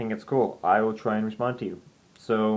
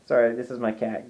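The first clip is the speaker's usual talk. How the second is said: ordinary talk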